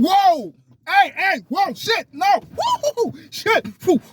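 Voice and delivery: high, loud dodging yelps; startled yelps. Acted. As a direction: yelp as if dodging blows. Acts out being hit.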